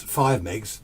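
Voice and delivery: ordinary talk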